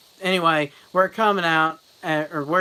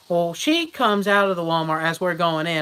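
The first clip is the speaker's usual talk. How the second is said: ordinary delivery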